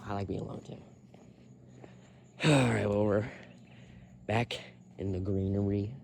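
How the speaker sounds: ordinary talk, nothing marked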